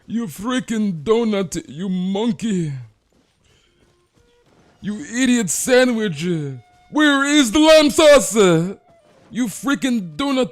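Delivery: slow, very loud, high chef rant; British chef yelling at full force; Gordon Ramsay impression. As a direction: scream it like a furious TV chef. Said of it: "impersonate a British accent".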